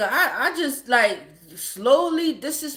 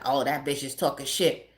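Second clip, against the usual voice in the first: lower, dismissive imitation of him; low friend voice; 12 semitones lower, 5 dB quieter than usual. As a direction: play the friend, low and careless. Quoting the friend, deep.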